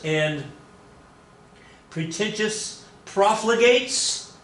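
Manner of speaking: high, slow, pompous orator; Henry Clay. Acted. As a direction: declaim it grandly, pitch swooping up on the insults. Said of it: "Henry Clay says".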